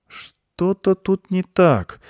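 slow, high, hesitant doubt; doubtful boy. A narrator's reading. Boy senses a flaw in the goat's teaching, doubtfully. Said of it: "с сомнением сказал мальчик".

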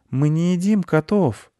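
put-on high-pitched child's protest; indignant little boy; objecting boy. A narrator's reading.

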